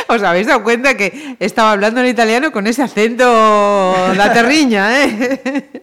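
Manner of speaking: pregunta entre risas, más alta y fuerte; riendo. Real, not acted. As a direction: se le escapa la risa mientras habla. Se ríe de su acento. (hablando entre risas, divertida)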